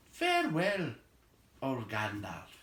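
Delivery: high, lofty elf king. Acted. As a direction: speak like a gracious elf lord.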